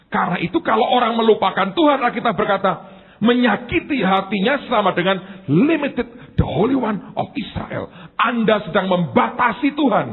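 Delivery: loud, high, emphatic sermon; preaching at full force. Real, not acted. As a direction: thunder it out to the church. (berkhotbah dengan lantang dan berapi-api)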